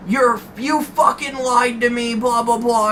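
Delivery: high-pitched imitation of a yelling boss; mock angry yell. Boss accusing his employee of lying, mock yell. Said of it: "he's yelling at me".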